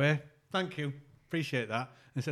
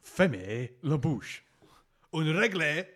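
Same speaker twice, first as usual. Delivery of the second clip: slow schoolbook French; mock French accent; 4 dB louder, much slower than usual. Host trotting out classroom French, slow and put-on. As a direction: spell out the French phrases slowly.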